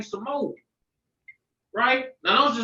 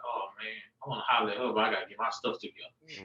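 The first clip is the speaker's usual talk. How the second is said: soft, quick, muttered-to-himself aside; quiet inner thought; 12 semitones lower, 9 dB quieter than usual. Retold or imitated. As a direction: think it fast and low under your breath. Retold inner thought about a girl.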